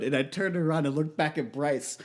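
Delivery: ordinary talk, nothing marked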